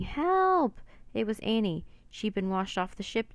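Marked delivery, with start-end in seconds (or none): 0.0-0.8 put-on much higher, louder girl's scream; screaming for help. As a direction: Annie screams from the waves, panicked. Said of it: "someone else screaming".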